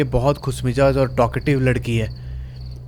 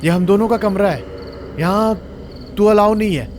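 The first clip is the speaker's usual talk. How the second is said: raised, higher voice, snapping in anger; angry flatmate yelling at a guest; furious outburst; 5 semitones higher than usual. Acted. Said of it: "मीता एकदम भड़क के उठती है".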